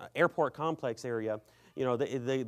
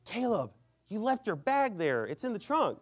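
high-pitched mock dismay; dismayed reminder; 7 semitones higher than usual. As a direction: break bad news to your friend in a higher voice. Acting out friend's dismayed reminder.

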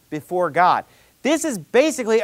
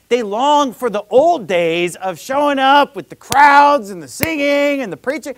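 impassioned longing; 7 semitones higher, 6 dB louder than usual. Real, not acted. Preacher on people missing crowded church, impassioned longing. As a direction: swell with nostalgia on each thing that is missed.

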